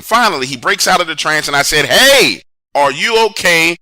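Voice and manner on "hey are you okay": loud, higher call of hey. Retold or imitated. Driver calls loudly to a man running beside the car. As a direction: shout it to get his attention.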